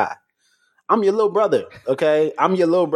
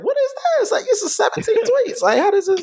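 high mock voice. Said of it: "And I was like".

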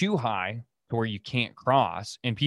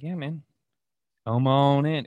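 a lightly voiced, higher crocodile coaxing him in; lazy croc invite; 5 semitones higher, 3 dB louder than usual. Crocodile mock-invites the fisherman into the river, lightly voiced. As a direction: tease him into the water like a lurking croc.

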